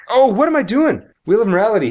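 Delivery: high-pitched self-reproach at forgetting; sudden realization. Real, not acted. Host reminded of forgotten Wheel of Morality segment, sudden realization. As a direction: exclaim as if you just remembered.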